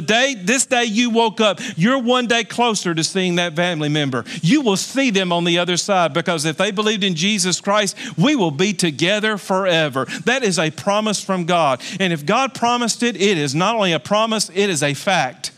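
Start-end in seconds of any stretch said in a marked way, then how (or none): none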